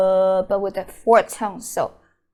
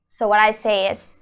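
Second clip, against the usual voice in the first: normal talking voice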